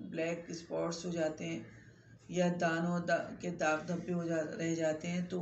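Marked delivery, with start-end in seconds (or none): none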